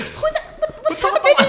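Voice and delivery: shocked bride. Retold or imitated. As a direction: squeak it out like a startled bride. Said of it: "And then she was like".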